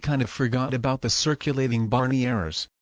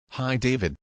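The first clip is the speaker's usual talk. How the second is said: usual speaking voice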